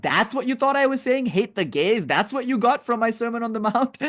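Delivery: high-pitched, comic disbelief; incredulous Christ. Acted. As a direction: act appalled at being badly misunderstood. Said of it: "the Christ comes back and he's like".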